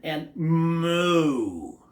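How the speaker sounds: drawn-out cow moo; weak moo. A narrator's reading. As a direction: puff up and let out a moo. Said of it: "puckered his lips".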